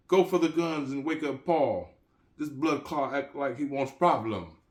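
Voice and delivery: high, loud alarm call to arms; urgent shout. Acted. Jamaican dealer orders his crew to grab guns, loud urgent Jamaican shout. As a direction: rouse the house for a fight. Jamaican rallies house for a fight.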